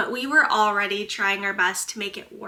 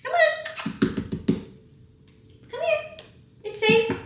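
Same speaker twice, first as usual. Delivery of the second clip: much higher sing-song pet voice; coaxing the cat. Real, not acted. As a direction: coax a frightened cat in a high voice.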